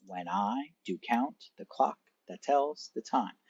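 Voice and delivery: slow, stressing every second syllable; iambic stress. Shown as a demonstration. Teacher reading a Shakespeare line to class, slow iambic stress. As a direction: read it da-DUM, leaning on each stressed beat. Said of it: "Unstressed followed by stressed".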